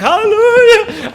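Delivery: high, loud joyous hallelujah, drawn out; Holy Ghost shout. Retold or imitated. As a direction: belt it like you just caught the spirit. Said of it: "I got the Holy Ghost".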